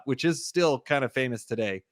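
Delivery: normal talking voice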